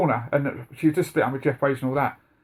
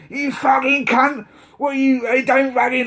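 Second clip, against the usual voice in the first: much higher, slow mock angry yell; retold outburst; 10 semitones higher, 3 dB louder, slower than usual. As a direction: blow up at him in an imitated rage. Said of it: "He goes".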